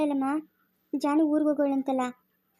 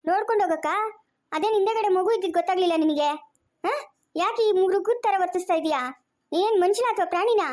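higher-pitched, indignant reproach; angry scolding; 5 semitones higher than usual. Acted. Woman rebuking her sister over the child behind her, angry scolding.

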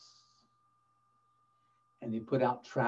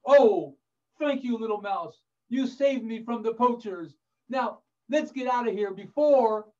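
high, hammed-up grateful lion; relieved lion; 7 semitones higher than usual. Freed lion thanks the little mouse, high and relieved. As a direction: gush thanks, then urge escape.

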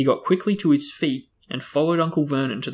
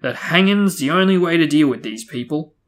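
table-pounding rant; 4 semitones higher, 6 dB louder than usual. A narrator's reading. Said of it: "pounding the table with his large purple fist".

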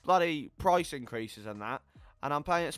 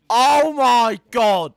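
loud, high, drawn-out outburst; loud dismay; 9 semitones higher, 12 dB louder, much slower than usual. Real, not acted. Gamer losing hope as the match goes wrong, loud dismay. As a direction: cry out in despair at the game. Dismay as his game collapses.

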